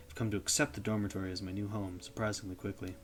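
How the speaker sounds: ordinary delivery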